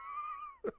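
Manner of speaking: squealing high-pitched laugh; real helpless laughter. Real, not acted. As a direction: break into a shrill giggle. Laughing hard at memory.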